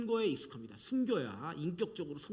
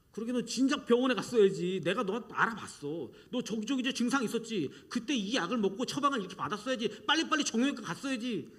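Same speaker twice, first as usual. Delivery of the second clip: know-it-all lecturing; 5 semitones higher, 5 dB louder than usual. Acted. Tactless mourner lecturing the grieving.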